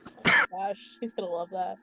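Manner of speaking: ordinary delivery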